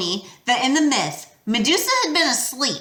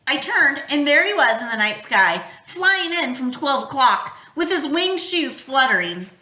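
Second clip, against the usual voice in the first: ordinary delivery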